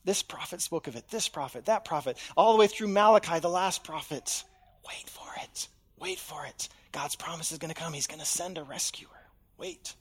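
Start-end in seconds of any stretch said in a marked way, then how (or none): 4.9-5.7 breathy whisper, barely there; whispered suspense; whispered tease. Acted. Preacher builds suspense, hushed. 6.0-6.7 softer, voiced murmur; hushed echo of the whisper; soft hushed repeat. Repeat, now softly voiced.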